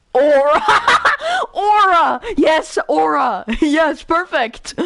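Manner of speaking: loud, high, laughing cheer; thrilled outburst breaking into laughs; gleeful excitement. Real, not acted. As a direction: shout it out through real laughter. Delighted laughing at word pick.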